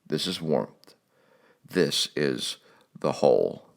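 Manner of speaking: soft, tender murmur; gentle, hushed, fading away. A narrator's reading. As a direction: hush it like a lullaby. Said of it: "Sometimes it rose to an aching chord that caught the throat, saying".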